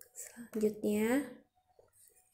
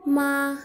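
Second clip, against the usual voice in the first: "ma" held high and level, a bit louder; level first tone; 3 semitones higher, 5 dB louder than usual. Shown as a demonstration. Teacher showing beginners the first Mandarin tone, high level "ma". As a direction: say "ma" on one steady high note. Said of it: "intonasi datar adalah".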